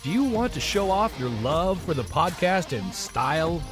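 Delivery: ordinary delivery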